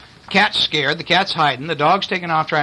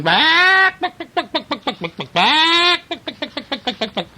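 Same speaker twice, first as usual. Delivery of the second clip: panicked hen clucking; 11 semitones higher than usual. Acted. Reader shows a child the startled hens, high clucking. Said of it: "The chickens are going".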